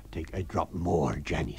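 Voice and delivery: calm him down with a drink; Mr Hall, soothing husband. Acted. Husband comforting his frightened wife.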